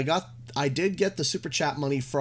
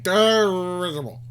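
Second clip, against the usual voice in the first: put-on lightly slurred drunken word; a hint of drunk mumbling; drunk mumble; 7 dB louder than usual. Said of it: "like a drunk person mumbling".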